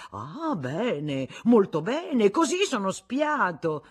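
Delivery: high, loud, slowing; indignant. Acted. Husband complaining he's spied on, indignant. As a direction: play the injured party.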